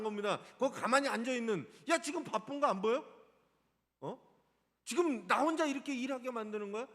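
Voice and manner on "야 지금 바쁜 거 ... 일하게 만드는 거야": louder, irritated scolding of a lazy sibling; fed-up nagging, higher and louder; annoyed sister. Acted. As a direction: snap at her sister for sitting idle. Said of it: "화가 난 거예요".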